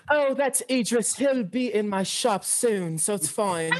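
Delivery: high-pitched, drawn-out mock British accent; imitated British accent. Host imitating guest bragging about Idris, mock British accent. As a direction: mimic a British woman, stretched out and high. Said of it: "That's pretty good".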